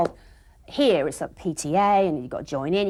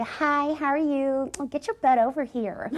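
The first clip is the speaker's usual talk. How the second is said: higher mock American teen; daughter's American voice; 6 semitones higher, slower than usual. Mum imitating daughter with her friends, higher mock American. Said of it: "she go".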